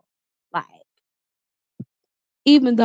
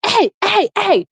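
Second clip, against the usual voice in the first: much higher calling out, imitated; mock scream; 11 semitones higher than usual. Female driver yelling at the boyfriend, high mock calling. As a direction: call out sharply like the driver did. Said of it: "started screaming at him".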